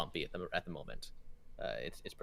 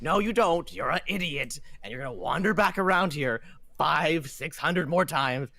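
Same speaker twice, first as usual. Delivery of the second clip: exasperated ship captain; 6 semitones higher, 7 dB louder than usual. Acted. Captain berates lost souls wandering back around, fed-up rant. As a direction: snap back, then stretch the insult.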